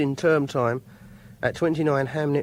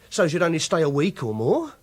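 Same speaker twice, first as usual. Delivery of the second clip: normal talking voice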